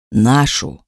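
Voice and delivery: low rasping beast voice; hoarse dragon rasp. A narrator's reading. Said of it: "прохрипел дракон".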